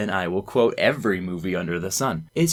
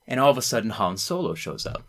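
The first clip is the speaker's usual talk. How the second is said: ordinary delivery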